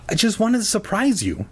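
high-pitched mock wounded voice; feigned hurt. A narrator's reading. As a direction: plead as if your feelings are hurt. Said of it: "pretending his feelings were hurt".

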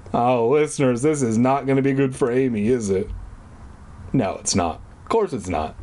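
plain reading voice

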